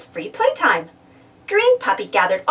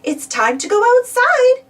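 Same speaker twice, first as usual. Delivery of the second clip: high, louder teacher's call across the room; 12 semitones higher, 5 dB louder than usual. A narrator's reading. Teacher calls the children to go outside, loud and high. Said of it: "called Miss Marigold".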